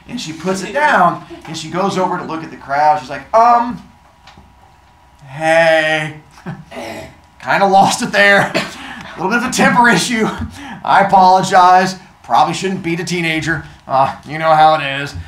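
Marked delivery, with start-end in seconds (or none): 3.3-15.1 loud, awkward, higher public voice; sheepish apology. Acted. Athena apologizing to the crowd, loudly awkward and sheepish. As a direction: laugh it off to a staring crowd. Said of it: "she has that embarrassing moment".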